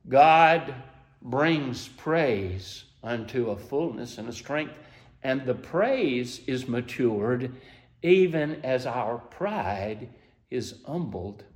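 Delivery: slow, soft and low sermon cadence; hushed preaching. Acted. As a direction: draw the congregation in, slowly and quietly.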